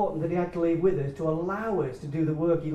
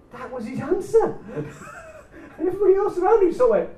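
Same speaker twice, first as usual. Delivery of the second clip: laughing; 13 semitones higher, 5 dB louder, faster than usual. Real, not acted.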